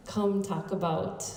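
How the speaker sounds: ordinary talk, nothing marked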